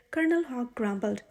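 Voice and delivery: plain reading voice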